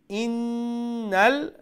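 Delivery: ইন্নাল high, doubled n held very long; ghunnah demo. Shown as a demonstration. Teacher modelling the two words, ইন্নাল with sustained n. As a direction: stretch the ন্ন of ইন্নাল into a hum. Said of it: "আমরা গুন্না করতে হবে".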